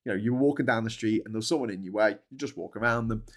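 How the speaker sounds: ordinary talk, nothing marked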